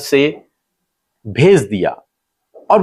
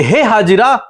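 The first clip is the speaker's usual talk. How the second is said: high, raised questioning voice; angel calling to Hagar; 10 semitones higher, 3 dB louder than usual. Retold or imitated. Angel asks weeping Hagar what happened, high raised questioning. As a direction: call out each question high, then let it fall. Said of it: "स्वर्गदूत उससे कहते हैं कि".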